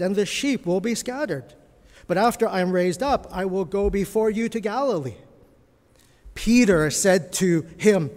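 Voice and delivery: measured, dramatic Bible reading to the congregation; a preacher reading the denial of Peter aloud; scripture reading. Acted. Reads Mark passage to church.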